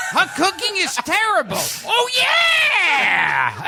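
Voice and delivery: high punchline capped by a wailing yell; Freddie Rogers catchphrase. Retold or imitated. Imitating a comic rescuing a cooking joke, high drawn-out shout. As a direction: pitch it up, then holler the catchphrase. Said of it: "right after the punchline".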